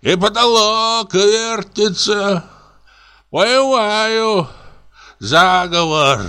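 put-on high, slow drunken ramble; drunk comic raving. Пьяный комик бредит. (пьяный бред, высоко и медленно)

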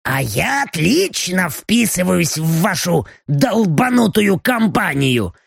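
slow, otherworldly demon voice. Acted. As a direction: speak like a lurking demon. Demon Belochka speaks. (голос демона)

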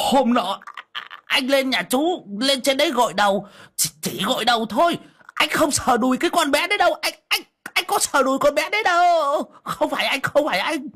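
high, whining, near-crying denial; frantic high whimpering excuse; panicked pleading. Acted. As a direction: beg him not to hurt you, near tears. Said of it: "méo sạch cả miệng".

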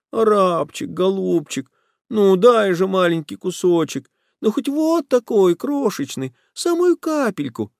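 very high, whining beggar plea; humble beggar's voice. Acted. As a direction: grovel for a tiny crumb. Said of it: "тот же униженный нищенский голос".

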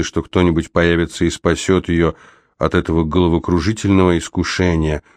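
ordinary delivery, nothing marked

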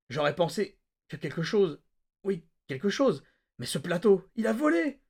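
giddy monologue peaking high; excited rant. A narrator's reading. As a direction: climb to disbelieving glee.

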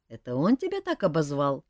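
ordinary delivery

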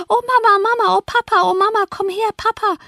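put-on high-pitched childish howl for parents; mock wailing. A narrator's reading. Linton children crying out for their parents, high mock wailing. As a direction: wail like frightened children, high and drawn. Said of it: "so heulten sie".

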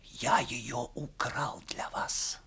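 slow secretive whisper; hushed confession told with mystery; mysterious whisper. A narrator's reading. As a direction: breathe it like a guilty secret, slowly. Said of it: "ответил ей таинственным шёпотом".

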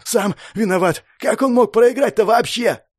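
high-pitched, exasperated protest; heated retort. A narrator's reading. Winded man blaming the dead friend, heated retort. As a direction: argue back, incredulous. Man shouting back in quarrel.

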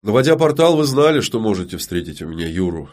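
usual speaking voice

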